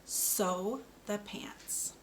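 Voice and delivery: "Sew the pants" said very slowly, each word apart; slow model sentence. Shown as a demonstration. Speech therapist models a sentence for a child, slow and careful. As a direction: draw it out slowly for the learner. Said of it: "Here we go".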